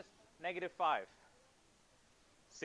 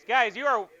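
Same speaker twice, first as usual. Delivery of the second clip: raised class call; 3 semitones higher, 8 dB louder than usual. Real, not acted. Teacher calling class to order.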